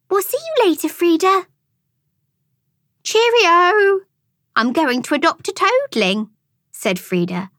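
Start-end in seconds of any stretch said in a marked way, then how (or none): 0.1-1.5 put-on high girl's voice, taking her leave; polite goodbye. A narrator's reading. Amelia politely takes her leave. 3.0-6.3 high, singing-out farewell; enthusiastic. A narrator's reading. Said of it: "hopping away enthusiastically".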